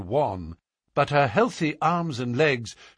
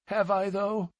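a hint of a sorry frontiersman; mildly apologetic; penitent man; 8 semitones higher, 3 dB quieter than usual. A narrator's reading. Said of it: "the man answered penitently".

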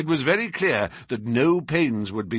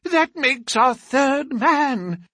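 excited; 5 dB louder, much slower than usual. A narrator's reading. Host thrilled at the news.

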